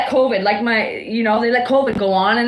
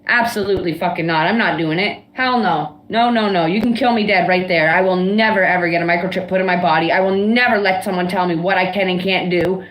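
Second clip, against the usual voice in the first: mad; 3 semitones lower than usual. Real, not acted. Ranting to viewers about being forced into a microchip, furious. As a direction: rage against being told what to do. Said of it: "She must be really mad at someone right now".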